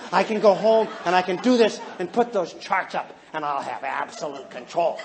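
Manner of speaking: put-on scheming voice, dropping low; mock tyrant relishing total control; power-hungry. Red parent planning to rule the children, power-hungry. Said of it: "in the way of power".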